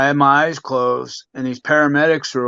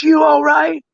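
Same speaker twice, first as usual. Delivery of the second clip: high, louder mom voice; imitating worried mom; 13 semitones higher, 5 dB louder than usual. Son imitating his mom checking on him after the fall, worried. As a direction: pitch it up as an anxious mother asking.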